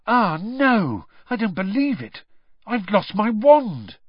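high-pitched cry of dismay. A narrator's reading. Harry finds his wand missing, dismayed. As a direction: wail it in disbelief.

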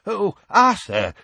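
high, slow and panicky; desperate. A narrator's reading. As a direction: plead it in alarm. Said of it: "he said desperately".

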